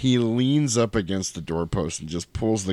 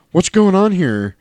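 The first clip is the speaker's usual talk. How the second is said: high, loud startled question; acted alarm; 9 semitones higher, 5 dB louder than usual. Podcaster playing woman facing drawn guns, mock startled. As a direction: pitch it up as if walking in on trouble.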